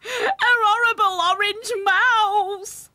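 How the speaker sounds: high, loud, drawn-out wail; hammed-up screaming aunt; horrified scream. A narrator's reading. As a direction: shriek each word slowly in horror. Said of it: "she screamed".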